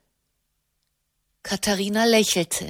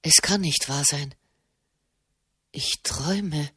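stunned inner voice; 6 semitones lower, 5 dB quieter than usual. Acted. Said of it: "dachte Marchesano".

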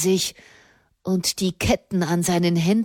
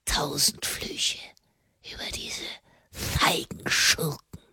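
hissing whisper; 5 semitones lower, 9 dB quieter than usual. A narrator's reading. Prisoner cursing the cowardly villains, hissing whisper. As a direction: whisper the curse like a hiss. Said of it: "Das Flüstern war wie ein Zischen".

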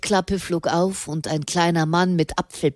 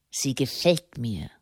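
low, softened, grudging approval; gruff snort; 5 semitones lower, 7 dB quieter than usual. A narrator's reading. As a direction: drop it low like a gruff commander. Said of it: "schnaubte er".

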